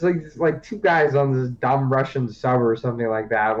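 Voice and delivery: ordinary talk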